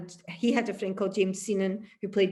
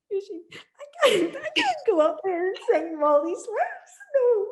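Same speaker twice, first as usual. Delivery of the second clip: high, strained near-tearful pleading, half laughing; panicked plea; 12 semitones higher than usual. Retold or imitated. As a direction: beg your bandmate not to make you go on. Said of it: "And I was like".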